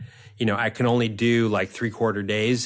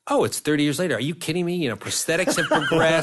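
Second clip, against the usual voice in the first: high, laughing, upbeat sales pitch; breezy reassurance, half laughing; breezy producer reassurance; 6 semitones higher than usual. Retold or imitated. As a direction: oversell it with a laugh. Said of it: "And Rob said".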